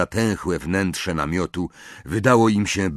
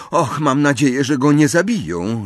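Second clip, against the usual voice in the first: higher-pitched, anxious plea; worried moan; 7 semitones higher, 5 dB louder than usual. A narrator's reading. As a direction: moan it out with worry. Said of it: "jęknęła Hermiona". (zmartwiony jęk)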